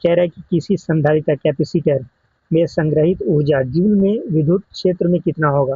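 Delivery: ordinary talk, nothing marked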